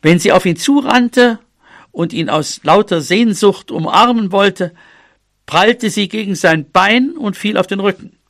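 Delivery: usual speaking voice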